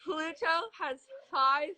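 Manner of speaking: talking through a cheek retractor; mouth guard in. Real, not acted. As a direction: lips stretched wide, words mangled. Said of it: "say it again".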